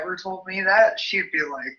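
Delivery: ordinary talk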